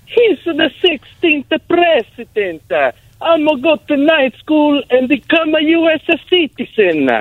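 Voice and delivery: Giuseppe's voice. Retold or imitated. Giuseppe answering in the joke.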